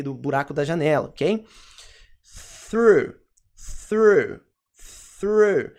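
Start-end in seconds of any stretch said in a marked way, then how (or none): 2.7-5.7 "through" with airy TH and long OO. Shown as a demonstration. Teacher introducing through, emphatic TH and OO. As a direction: drill "through" apart, higher, falling each time. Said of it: "É o mesmo som de T H".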